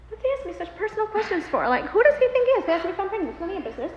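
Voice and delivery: fast, high offended rant; retold huffy inner outburst; indignant. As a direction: bristle at a nosy question, rushing. Offended inner reaction to personal question.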